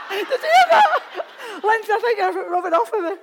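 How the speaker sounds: high, loud words through real laughter; cracking up while talking; laughing hard. Real, not acted. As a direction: squeal it out, helpless with laughter. Laughing at own accent slip.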